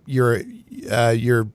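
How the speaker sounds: normal talking voice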